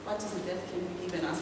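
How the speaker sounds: ordinary talk, nothing marked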